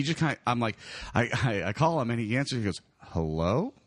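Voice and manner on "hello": low, confused phone answer; Matt. Retold or imitated. Friend picking up the phone, low imitated confusion. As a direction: answer slowly rising, mildly puzzled, sober. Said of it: "way too sober sounding and almost confused".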